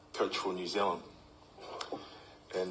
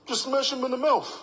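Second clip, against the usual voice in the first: much higher, louder mock coach bark; coach's blunt order; 8 dB louder than usual. Coach telling player how to block, loud high mock bark. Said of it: "He'd just go".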